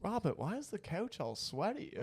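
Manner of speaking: put-on high nagging old lady. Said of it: "you think my mom sounds like that?".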